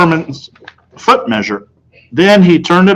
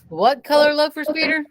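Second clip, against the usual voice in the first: higher, soft question; salesman asking; 13 semitones higher, 7 dB quieter than usual. A narrator's reading. Salesman asking Peter which color, soft and higher. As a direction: ask the boy kindly. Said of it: "Mister Berman asked".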